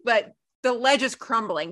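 usual speaking voice

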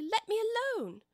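high, drawn-out indignant protest. Acted. Alice fending off the pigeon's wings, high and indignant. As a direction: push it away, pitched right up. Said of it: "said Alice indignantly".

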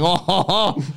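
hammed-up German-tinged foreign accent; mock German-French accent. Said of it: "you're hearing it's German accent now".

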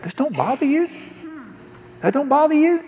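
high, rising astonished questions; amazed disbelief. Retold or imitated. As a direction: ask it like you can't believe their calm. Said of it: "people look at you and say".

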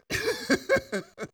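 a high, loud burst of laughter. Real, not acted. Pastor laughing at himself before the congregation, real high laugh. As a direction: laugh at your own cop-out. Laughing at own phone flub.

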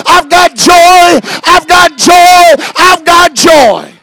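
high, loud, slow shouted preaching; full-force preaching. Acted. Preacher proclaiming joy to the church, shouting. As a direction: shout your joy to the rafters.

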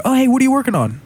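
high-pitched curious greeting; lightly acted friendly inquiry; friendly nosy stranger. Acting out nosy stranger's friendly question.

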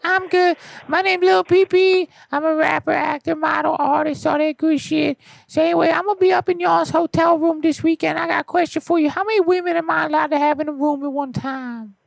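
very high cartoon rapper voice; Lil P P voice. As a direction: talk like a helium-pitched rapper. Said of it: "I'll put him on the phone".

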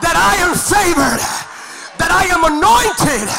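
slow emphatic declaration. Real, not acted. Congregation repeats declaration after him.

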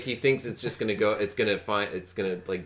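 ordinary talk, nothing marked

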